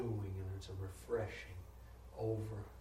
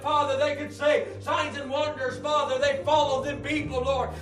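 loud, higher preaching cry with Lord after every line; preaching at full force; 18 dB louder than usual. Acted. Pastor crying out to God for the church, full-force preaching. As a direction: pour it out like a revival preacher.

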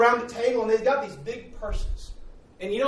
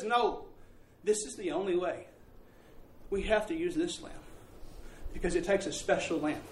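solemn father; 2 semitones lower, 10 dB quieter than usual. Retold or imitated. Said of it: "He says".

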